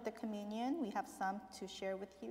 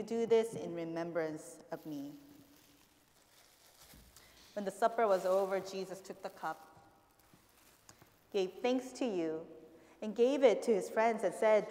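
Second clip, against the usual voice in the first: liturgical prayer; 5 dB louder than usual. Real, not acted. Recites words of institution.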